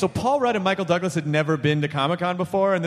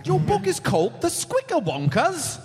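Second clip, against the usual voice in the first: attempted Scottish accent; 8 semitones higher than usual. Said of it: "only try Scottish".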